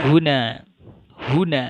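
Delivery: "Huna" loud and high on "hu", falling on "na"; no stress on final vowel. Shown as a demonstration. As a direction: punch the first syllable of "Huna", let the end fall. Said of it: "pada akhir kata tidak pernah diberi". (tekan "hu" dalam "Huna", akhirnya turun)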